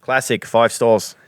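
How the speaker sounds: slow, put-on Aussie read; jokey Australian accent; mock Australian accent. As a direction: stretch it out like an Australian fan. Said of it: "in Australia".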